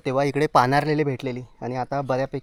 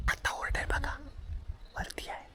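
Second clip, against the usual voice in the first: real hushed whisper so the rooster won't hear; 12 semitones higher, 5 dB quieter than usual. Real, not acted. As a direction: breathe the words, no voice at all. Hiding near a wild rooster. (कोंबडा ऐकू नये म्हणून कुजबुज)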